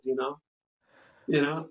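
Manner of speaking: ordinary talk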